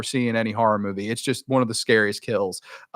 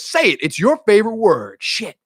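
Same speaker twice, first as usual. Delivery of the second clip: mock goading; 7 semitones higher than usual. Killer goading her victim.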